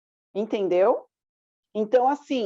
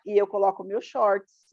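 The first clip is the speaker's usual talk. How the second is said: usual speaking voice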